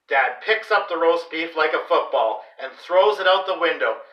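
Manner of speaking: ordinary delivery, nothing marked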